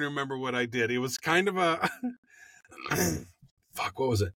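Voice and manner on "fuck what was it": usual speaking voice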